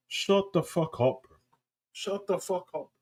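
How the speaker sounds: soft, slow disbelieving mutter; quiet, slow exasperation; muttered disgust. Real, not acted. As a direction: say it under your breath, fed up. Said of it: "exasperated at promo".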